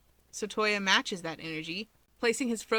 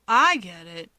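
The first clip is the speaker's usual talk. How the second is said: louder, sudden realisation; perked up; 9 dB louder than usual. A narrator's reading. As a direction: it just clicked. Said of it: "Toya perks up".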